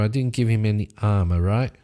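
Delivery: ordinary talk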